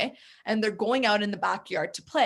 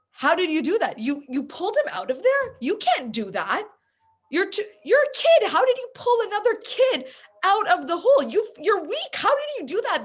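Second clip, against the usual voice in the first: much higher and louder, amazed disbelief; incredulous adults; 10 semitones higher, 5 dB louder than usual. Retold or imitated. Adults questioning the child who saved his friend, higher and louder in disbelief.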